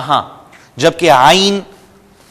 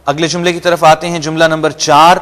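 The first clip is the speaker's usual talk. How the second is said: ordinary talk, nothing marked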